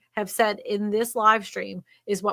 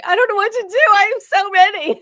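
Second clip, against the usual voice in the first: much higher, louder, whiny overwhelmed wail; mock crying student; 11 semitones higher, 9 dB louder than usual. Teacher mocking a student overwhelmed with leads, high whiny wail. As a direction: ham up a swamped student laughing through a whine. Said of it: "these people crying, going".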